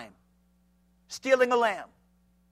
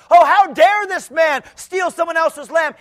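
slow indignant shout; irritated David; 8 semitones higher, 10 dB louder, slower than usual. Retold or imitated. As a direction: play David outraged at the thief. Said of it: "David was irritated".

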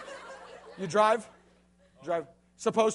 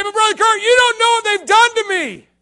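higher and louder, complaining; whiny listener; 12 semitones higher, 12 dB louder than usual. Retold or imitated. A listener objecting to the preacher, whiny and high. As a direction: protest like a wronged teenager. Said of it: "You say".

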